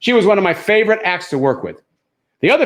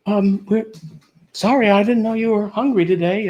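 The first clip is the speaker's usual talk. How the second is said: higher, hesitant comic pleading; mock-meek voice placating a threat; timid apology; 6 semitones higher than usual. Tourist apologising to a hungry lion, timid and higher-pitched. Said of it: "And I was like".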